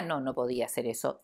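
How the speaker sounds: ordinary delivery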